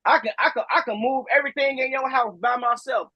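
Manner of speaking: ordinary talk, nothing marked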